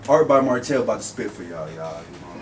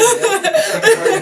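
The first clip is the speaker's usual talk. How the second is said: high, laughing start; giggling as the story begins; 7 dB louder than usual. Real, not acted. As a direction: laugh through the first words. Laughing while starting an answer.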